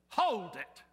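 a sudden loud halt; sharp stop. Retold or imitated. Girl cutting off the preacher's questions, a sharp loud halt. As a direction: cut him off short.

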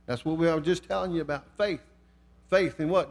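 usual speaking voice

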